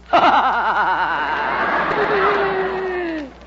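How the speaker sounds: long, high burst of laughter; loud gloating laugh. Acted. Higgins crows over Hooper's returned shoes, loud gloating laugh.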